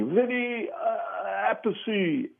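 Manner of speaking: high, soft, careful imitation. Singer introducing drummer while seeking his approval, high hesitant imitation. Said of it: "really concerned to get it right".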